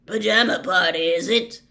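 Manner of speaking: put-on loud, growling old-soldier voice; growled. A narrator's reading. Ex-Auror calling up to the men on the stairs, growling. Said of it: "he growled up the stairs".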